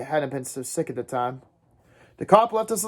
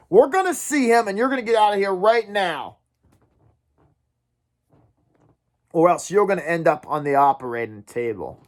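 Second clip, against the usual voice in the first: shaking threat; 3 semitones higher than usual. Acted. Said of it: "His voice was shaking".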